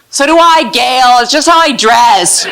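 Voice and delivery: high, pointed mock reply; sarcastic comeback. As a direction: snap it back with sass. Snappy comeback to the lady.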